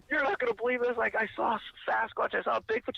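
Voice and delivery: high, breathless announcement; retelling an excited telling of a Bigfoot sighting; excited disbelief. Retold or imitated. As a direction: blurt out the unbelievable news.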